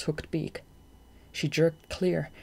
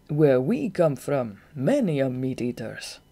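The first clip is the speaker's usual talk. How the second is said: slow, louder explanation; explaining; 4 dB louder than usual. A narrator's reading. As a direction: Goth explaining his jungle ways, calm explanation. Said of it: "Goth explained".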